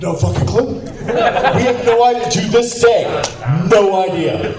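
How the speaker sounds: high-pitched, emphatic bafflement; real, high comic exasperation; exasperated disbelief. Real, not acted. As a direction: throw it out incredulously, voice way up. Emphatic answer, audience laughing.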